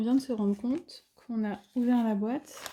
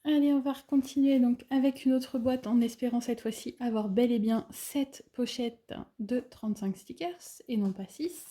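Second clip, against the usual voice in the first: ordinary talk, nothing marked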